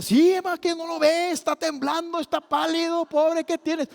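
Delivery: high, loud, fussing father's voice; worried father. Retold or imitated. Father tells mother the son looks pale, high worried fussing.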